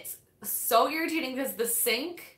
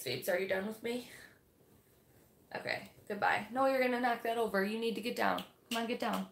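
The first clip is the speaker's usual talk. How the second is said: softer aside to the pet; scolding the cat gently under her breath; talking to cat; 5 dB quieter than usual. Real, not acted. Vlogger quietly telling her cat to get down.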